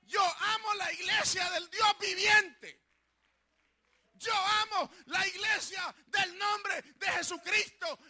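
loud, high fervent preaching shout; preaching at full force. Real, not acted. Pastor rebuking the devil before his congregation, shouting high and loud. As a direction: thunder it out with full conviction.

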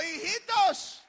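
high, loud, slow hail; calling out. Acted. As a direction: call out to them, stretching the word. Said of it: "Les dijo".

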